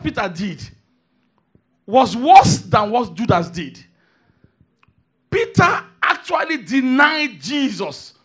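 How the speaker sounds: loud, high, shouted preaching; preaching at full force. Real, not acted. Preacher shouting to the congregation about Peter's denial, high and loud. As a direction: hammer every word home.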